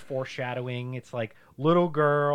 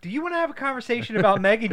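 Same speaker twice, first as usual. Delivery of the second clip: excited; 8 semitones higher, faster than usual. Retold or imitated. Quoting own excited text.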